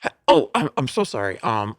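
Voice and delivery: a hurried dwarf stammering apologies; frantic dwarf. Acted. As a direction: blurt it out in a panic.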